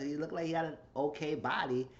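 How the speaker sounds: ordinary talk, nothing marked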